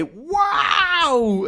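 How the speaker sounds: high startled cry of wow; startled shout. Acted. Crowd seeing Ranko, startled shout. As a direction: yelp in sudden fright.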